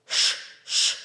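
Ш, ш hissed without voice; voiceless sh. Shown as a demonstration. Teacher isolating the first sound of a word, soft Ш, ш. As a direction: breathe out a soft Ш, ш. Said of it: "Шафа".

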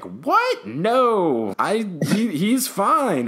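high-pitched mock disbelief; shocked denial. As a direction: blurt it out high, imitating a defensive student. Retelling Harry's shocked denial of vision.

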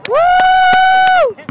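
very high, loud whoop; loud high sideline whoop; cheering whoop. Real, not acted. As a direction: let out a piercing woo.

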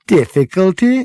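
high-pitched, rising disbelief; incredulous exclamation. A narrator's reading. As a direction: throw the word back in disbelief. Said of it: "exclaimed Ford".

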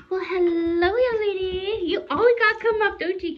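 high, loud gushing greeting. Real, not acted. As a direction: squeal hello to the puppy. Greeting puppy at camera.

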